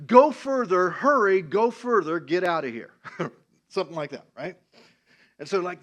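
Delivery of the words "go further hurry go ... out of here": loud, higher call to someone far off. Retold or imitated. Jonathan yelling a coded warning past the little boy, calling out. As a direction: urge the boy onward at a shout.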